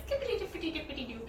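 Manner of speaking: lightly voiced, playful kid rhyme; sing-song Laura. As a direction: rattle it off like a carefree little girl. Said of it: "and then Laura's like".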